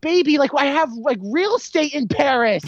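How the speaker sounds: high, hammed-up smooth husband; lightly voiced husband playing it cool; mock DiCaprio. Husband soothing his wife, mock high voice. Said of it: "He's like".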